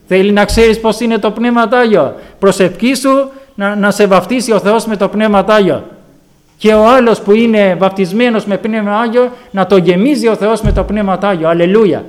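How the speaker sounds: full-throated Pentecostal appeal; preaching. Acted. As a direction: urge the flock to pray for the Spirit. Exhorting the congregation.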